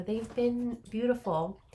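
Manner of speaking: normal talking voice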